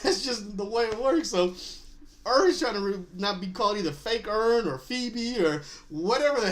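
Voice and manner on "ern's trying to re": normal talking voice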